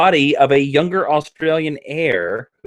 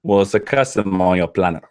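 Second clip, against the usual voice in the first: attempted Australian accent; 7 semitones lower than usual. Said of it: "You have to be Australian".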